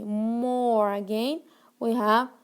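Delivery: usual speaking voice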